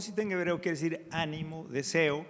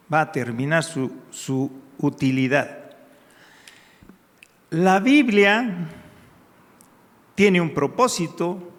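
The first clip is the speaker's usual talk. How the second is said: loud, driving sermon voice; fervent pulpit preaching, much louder; full-force preaching; 8 dB louder than usual. Acted. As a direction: preach it out loud to the whole church.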